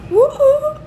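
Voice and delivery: excited cheer. Real, not acted. Friend cheering out suddenly.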